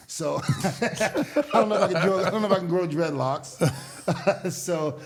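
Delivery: words bubbling with real laughter; laughing while talking. Real, not acted. Telling the host about dreadlocks, laughing. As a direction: laugh through the line about the thinning hair.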